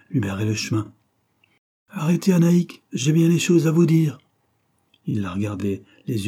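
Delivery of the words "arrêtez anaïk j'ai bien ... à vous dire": higher, louder urgent demand to stop; urgent call. A narrator's reading. Suitor barring the girl's way, urgent call. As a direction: stop her with a raised, insistent voice. Suitor blocks her path.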